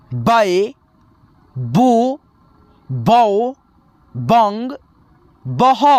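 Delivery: slow teacher chanting each syllable apart; syllable chart recitation. Shown as a demonstration. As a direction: drill the barakhadi one syllable at a time.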